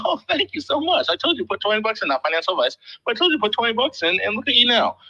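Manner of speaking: high, fast smug I-told-you-so; mock gloating. Host gloats to grateful fan, high mock smugness.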